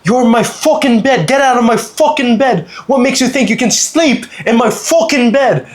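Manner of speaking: high, indignant mock-British rant; hammed-up British tourist losing it; British accent. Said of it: "the British guy's like".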